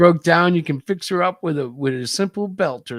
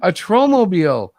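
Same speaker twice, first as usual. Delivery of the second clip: amazed; 5 semitones higher, 7 dB louder than usual. Acted. Story employee's amazed line.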